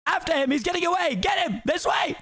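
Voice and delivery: high frantic chase shouts; guards shouting. Acted. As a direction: yell after the fleeing donkey. Guards chasing the donkey.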